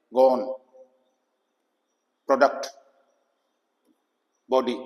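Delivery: gone, प्रोडक्ट, बॉडी with a short open O; short O sound. Shown as a demonstration. Teacher giving mid-word examples, short O. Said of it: "तो short ओ आया".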